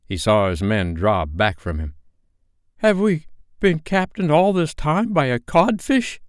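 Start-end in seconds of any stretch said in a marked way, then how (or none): 2.8-6.2 high-pitched indignant henchmen; disgruntled crew, voices pitched up; grumbling pirates. A narrator's reading. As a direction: complain in a high, affronted voice. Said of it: "they muttered".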